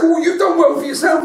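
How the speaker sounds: high, quick and laughing; impressed. Retold or imitated. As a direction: tease him, amused and impressed. Mary impressed by old friend.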